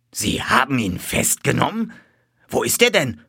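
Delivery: incredulous rising question; astonished. A narrator's reading. Colonel hearing of the capture, astonished. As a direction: ask in open-mouthed amazement. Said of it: "Wir starrten ihn beide mit offenem Mund an".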